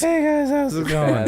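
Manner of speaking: put-on high, cracking teenage greeting; squeaky puberty voice. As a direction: play up an awkward puberty squeak. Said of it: "squeaky puberty voice".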